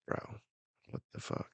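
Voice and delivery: very soft, whispered disbelief; stunned whisper. Real, not acted. Reader reacting to the rejected baby, stunned whisper. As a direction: mutter it under your breath, appalled.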